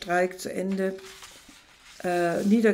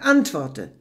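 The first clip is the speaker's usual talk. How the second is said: angry demand; 7 dB louder than usual. Acted. Kick, demand.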